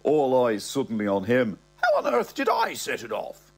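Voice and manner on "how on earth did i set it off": put-on pompous old man.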